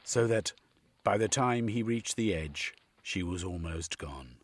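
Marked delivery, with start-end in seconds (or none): none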